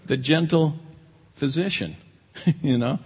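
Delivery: ordinary talk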